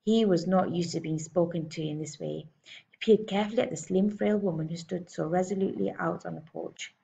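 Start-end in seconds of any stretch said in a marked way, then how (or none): none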